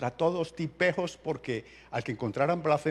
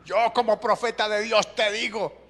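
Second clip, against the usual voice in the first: higher, pompous prophet voice; mocking self-appointed prophet; 6 semitones higher than usual. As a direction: intone it like a self-important prophet. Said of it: "¿ha escuchado alguna vez esa?".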